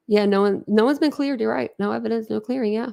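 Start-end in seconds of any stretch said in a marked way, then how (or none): none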